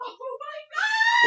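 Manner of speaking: slow, very high squeal; real overwhelmed delight; overjoyed. Real, not acted. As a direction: squeal it out in disbelief. Overwhelmed by a surprise gift.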